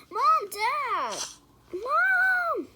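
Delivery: high, loud frightened call for the parents; scared call. Acted. Scared daughter calling her parents at night, high cry. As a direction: yell for mom and dad in fear. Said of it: "pretend like she's running for her life".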